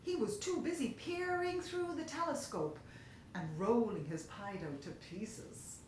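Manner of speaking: plain reading voice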